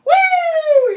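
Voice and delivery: high, loud drawn-out "woo"; whooshing whoop. Retold or imitated. Telling how the dog bolted, high whoop. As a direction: imitate a dog zooming off.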